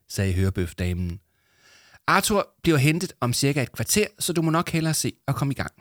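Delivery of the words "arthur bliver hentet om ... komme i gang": lightly higher, businesslike voice; brisk studio assistant. Acted. Headset lady telling Yama to get started, brisk. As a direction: hurry them along, a hint of a busy assistant.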